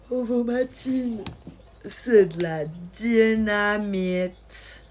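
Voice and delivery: slow, drawn-out advertising jingle delivery; mock ad slogan. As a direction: stretch every syllable like an old commercial. Recites the Ovomaltine advertising slogan.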